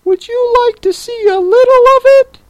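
very high, quick, loud creature voice; eager Mock Turtle. A narrator's reading. Mock Turtle offers Alice a demonstration, high and quick. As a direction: offer it fast in a high pipe.

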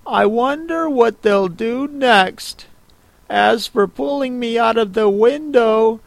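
put-on high young girl's voice, wondering; Alice talking to herself. A narrator's reading. Alice thinking aloud.

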